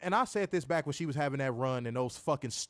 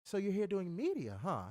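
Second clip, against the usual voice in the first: smooth slow cadence; 4 semitones higher, 6 dB quieter than usual. Retold or imitated. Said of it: "Slowed her cadence down real smooth".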